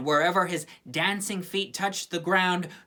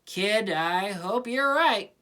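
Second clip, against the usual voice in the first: slow, weary sigh of a line; resigned and slow; 3 semitones higher, slower than usual. A narrator's reading. Peppermint Patty to the hopeful kid, slow and weary. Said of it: "Peppermint Patty sighed".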